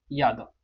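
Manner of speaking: "यादव" begun with a y glide; correct Y sound. Shown as a demonstration. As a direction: say "यादव" with y, not j. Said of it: "ये यादव है".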